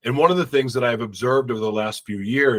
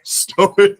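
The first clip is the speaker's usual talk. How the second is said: laughing retry; 8 semitones higher, 6 dB louder than usual. Real, not acted. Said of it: "I'm gonna say it wrong".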